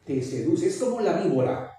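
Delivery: ordinary talk, nothing marked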